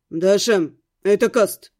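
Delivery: kingly announcement. A narrator's reading. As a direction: announce yourself as if royalty has arrived. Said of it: "Сказано было с такой интонацией, будто ко мне король пожаловал".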